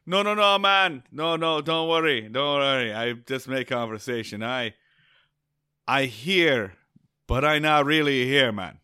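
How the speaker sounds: high, loud, hammed-up Rastafarian accent; terrible Rastafarian accent. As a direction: play the laid-back Rastafarian guide, badly on purpose. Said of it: "I'm gonna do a terrible Rastafarian accent".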